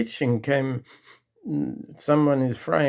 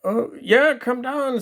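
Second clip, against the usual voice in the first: husband calling his wife; calling upstairs; 10 semitones higher than usual. Retold or imitated. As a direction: shout up the stairs. Man calls up to wife.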